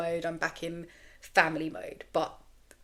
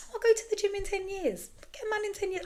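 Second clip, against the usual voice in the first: breezy carefree quote. Retold or imitated. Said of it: "I'm like".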